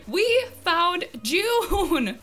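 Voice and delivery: very slow, high, loud cheer; drawn-out triumphant shout. Real, not acted. Gamer savouring the find with viewers, drawn-out triumphant shout. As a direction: stretch every word into a long victory cry.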